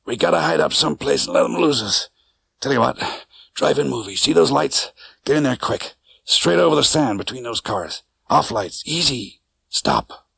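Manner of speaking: lightly voiced cabbie, urgent directions; quick, tense instructions from a hurt driver; wounded cabbie.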